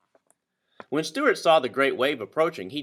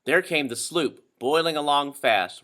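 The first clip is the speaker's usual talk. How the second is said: plain reading voice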